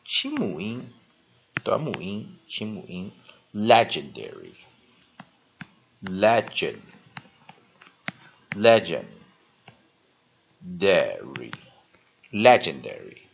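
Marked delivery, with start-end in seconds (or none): 3.5-13.2 "legendary" said deliberately, louder, falling at the end; teacher drilling "legendary" for class; clear model pronunciation. Shown as a demonstration.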